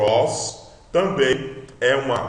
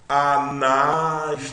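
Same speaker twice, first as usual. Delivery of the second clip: long, held syllables; sounding out a word; 3 semitones higher, 4 dB louder, much slower than usual. Shown as a demonstration. Teacher introduces a Greek word, sounded out slowly. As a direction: stretch "Anastrefo" out slowly. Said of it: "Vamos ver".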